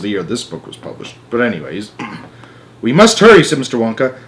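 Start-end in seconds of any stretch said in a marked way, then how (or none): 2.8-3.4 high, loud eager hurry; excited. A narrator's reading. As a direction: urge them on brightly. Wonka excited.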